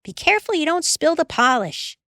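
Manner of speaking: a hint of a high, fussy nag; an older sister's mild nagging. A narrator's reading. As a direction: lightly scold the little brother. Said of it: "hurrying and nagging".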